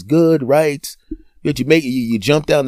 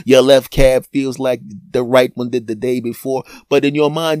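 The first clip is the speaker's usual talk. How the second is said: normal talking voice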